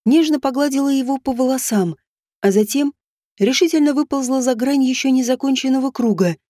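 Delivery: ordinary delivery, nothing marked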